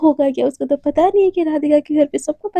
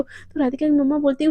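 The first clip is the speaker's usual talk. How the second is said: ordinary delivery, nothing marked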